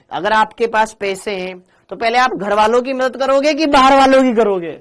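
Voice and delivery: high, loud challenge to the class; loud rhetorical question; emphatic question. Real, not acted. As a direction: press the class for an answer. Teacher challenging class loudly.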